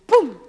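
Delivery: shouted thud sound; loud boom noise. Acted. Storyteller punching a falling-bolt sound. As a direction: bang it out.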